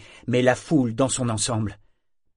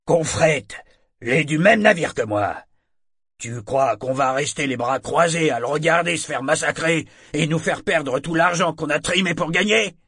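raised, higher-pitched angry protest; indignant challenge; 6 semitones higher, 5 dB louder than usual. Acted. Sailor defends his shipmate's lost money to the trainer, indignant. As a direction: argue hotly on behalf of a shipmate. Brute rouses crowd against trainer.

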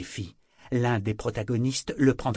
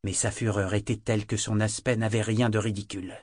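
plain reading voice

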